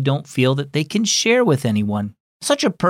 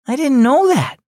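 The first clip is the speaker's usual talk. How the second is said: higher, a touch of dismay; shocked girl; 12 semitones higher, 5 dB louder than usual. A narrator's reading. Hearing about the divorce, a girl's mild dismay. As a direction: sound struck by the news. Naya's voice.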